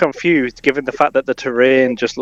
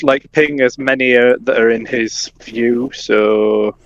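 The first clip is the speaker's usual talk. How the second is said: normal talking voice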